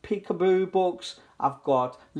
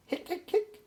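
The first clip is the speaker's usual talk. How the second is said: much higher, softer hiccup squeaks; bird hiccups; 5 dB quieter than usual. Acted. Four birds with the hiccups, small high hiccups.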